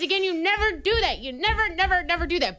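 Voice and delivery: high, insistent repeated never; emphatic never. Real, not acted. Scolding herself about asking, pressing each never. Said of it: "I hate myself for saying that".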